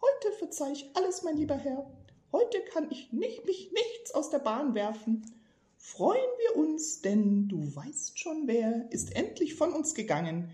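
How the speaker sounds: very high, squeaky, slow in places; squeaky old man. Acted. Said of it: "mit piepsiger Stimme sagte".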